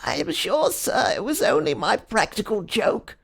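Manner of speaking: high pleading whimper. A narrator's reading. Wretched culprit pleading with the detective, whimper. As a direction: whine it out like a cornered man. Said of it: "began to whimper".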